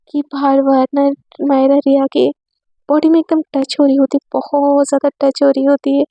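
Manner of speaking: ordinary delivery, nothing marked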